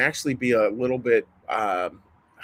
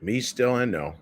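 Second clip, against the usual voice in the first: guided meditation; 3 dB quieter than usual. Acted. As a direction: let each line fall away into the silence. Leads a meditation.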